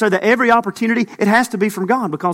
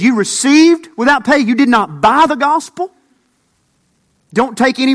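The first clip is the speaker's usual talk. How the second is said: louder, higher, preaching it out; forceful Jesus quote; 5 semitones higher, 5 dB louder than usual. Acted. Preacher voicing Jesus' command to disciples, forceful.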